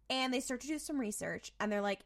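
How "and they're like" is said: usual speaking voice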